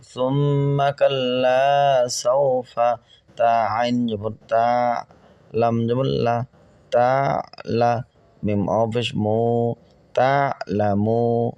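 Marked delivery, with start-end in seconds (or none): none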